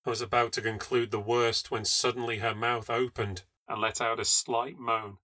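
ordinary delivery, nothing marked

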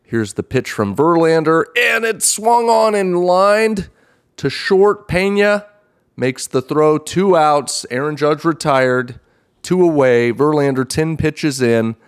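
high, loud excited call; rising excitement on the big play. Pretend. Announcer calls a big play.

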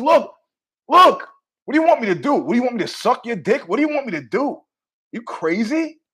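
fed-up buddy arguing back; exasperated. Retold or imitated. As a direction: fire off exasperated questions. Acted exasperated retort to husband.

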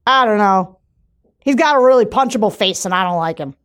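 a light New England twang, blunt voter; New Hampshire accent. Retold or imitated. Host retries the New Hampshire voter's accent. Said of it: "I can't do these accents".

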